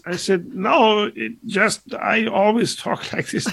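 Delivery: put-on very high, slow German accent; Michael Young. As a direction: draw out a joke in a high-pitched German voice. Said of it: "there's a Michael Young impression".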